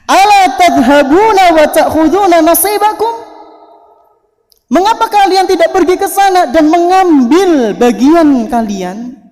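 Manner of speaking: high, loud urging cry; calling out. Acted. Abu Hurairah urges the market people to go, calling out. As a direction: shout a challenge to the crowd.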